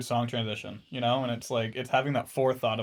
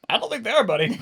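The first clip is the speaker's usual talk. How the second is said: high-pitched, louder mock doubt; mock doubtful warning; 9 semitones higher, 5 dB louder, faster than usual. Friend doubts his buddy will get Freebird, mock warning. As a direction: lift the voice to caution a friend.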